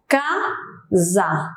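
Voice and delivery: "Casa" in two separate beats, ca, za; split into syllables. Shown as a demonstration. Teacher counts impulses for students, "Casa" syllable by syllable. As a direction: break "Casa" apart with a clear pause between syllables. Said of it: "eu tenho dois impulsos aí".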